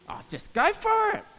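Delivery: high casual reply; breezy high coordinator voice. Retold or imitated. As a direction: toss it off like it's nothing. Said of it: "and I said".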